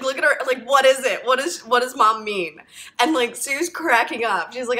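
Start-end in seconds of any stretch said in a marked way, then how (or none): 0.0-2.6 1 puzzled excitement. Retold or imitated. Chelsea's puzzled reaction retold.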